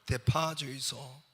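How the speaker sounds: slow, stretched Gyeongsang request; Gyeongsang dialect. Acted. Young customer repeating his order, slow Gyeongsang dialect. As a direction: draw out the vowels in Gyeongsang dialect. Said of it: "경상도 청년이 서울에 와서".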